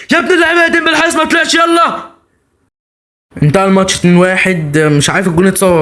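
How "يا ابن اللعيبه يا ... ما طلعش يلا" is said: high excited yell. Real, not acted. Gamer yelling at his striker in the final attack, high thrilled cry. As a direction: pitch flies up in a thrilled cry.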